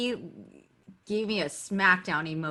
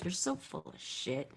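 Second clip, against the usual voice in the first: blunt father; 12 dB quieter than usual. Retold or imitated. Father's blunt reply, retold.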